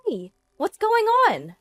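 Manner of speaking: mistress's high-pitched squawk; sudden shriek. A narrator's reading. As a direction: shriek it from across the room. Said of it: "a woman's voice suddenly shrieks".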